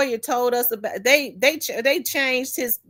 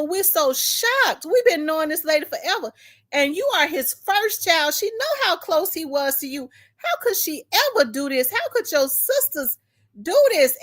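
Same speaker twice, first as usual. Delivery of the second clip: shocked aunties; 7 semitones higher than usual. Retold or imitated.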